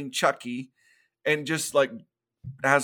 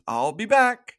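lightly voiced doll, higher and louder; a hint of a doll's voice, pitched up; 7 semitones higher, 5 dB louder than usual. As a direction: lightly put on a talking doll's voice. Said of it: "says in the doll voice".

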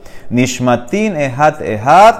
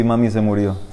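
quiet repeat; 6 semitones lower, 7 dB quieter than usual. Retold or imitated. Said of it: "¿qué está hablando así?".

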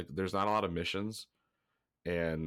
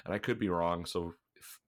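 ordinary talk, nothing marked

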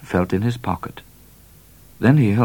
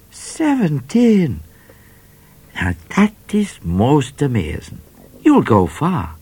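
much higher, slow, drawn-out seventeen; amazed trooper; 9 semitones higher, 4 dB louder, slower than usual. A narrator's reading. Trooper amazed at Midnight's age, high and drawn out. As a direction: marvel at it.

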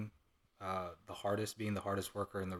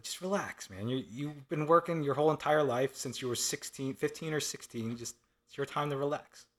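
higher-pitched, mock-exasperated urging; imploring; 6 semitones higher, 4 dB louder than usual. Son telling his retired dad to relax, high-pitched imploring. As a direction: pitch it up, pleading with him to ease off.

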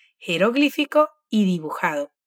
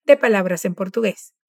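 normal talking voice

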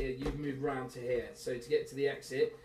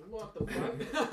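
baffled reaction; 6 semitones higher, 4 dB quieter, much slower than usual. Acted. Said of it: "they hear this booming voice".

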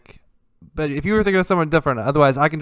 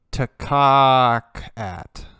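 very slowly stretched tacocat; drawn-out; much slower than usual. Shown as a demonstration. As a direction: drag out tacocat letter by letter. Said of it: "what happens when you spell taco cat backwards".

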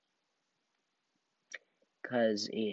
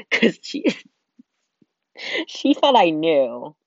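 higher and louder through laughter; laughing; 6 semitones higher, 7 dB louder, slower than usual. Real, not acted. Telling the dentist mix-up, laughing through the words. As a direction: giggle the words out. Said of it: "laughing while telling".

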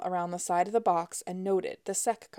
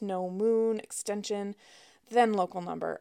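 usual speaking voice

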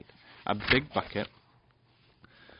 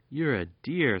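affectionate thanks; 5 semitones higher, 5 dB louder, slower than usual. Acted. Fenella thanking the dog.

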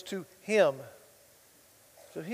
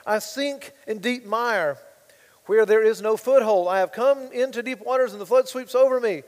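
louder, higher pleading psalm read; crying out; 3 semitones higher, 10 dB louder than usual. Acted. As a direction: cry the psalm out as a plea. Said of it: "King David said".

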